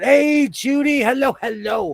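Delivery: high, drawn-out hail; calling out hello. Retold or imitated. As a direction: sing out a greeting, stretched and high. Greeting Judy at a restaurant.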